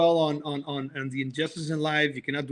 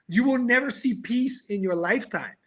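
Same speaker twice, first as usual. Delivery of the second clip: ordinary talk, nothing marked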